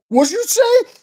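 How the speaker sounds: high, pushed-up mock threat; a high mock menace from a biker; Pat's challenge. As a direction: throw the challenge back at him. Pat threatening him.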